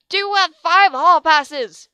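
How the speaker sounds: slow, loud, high scream into their ears; a furious teacher screeches at the students. A narrator's reading. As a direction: shriek the question at them. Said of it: "she screamed into their ears".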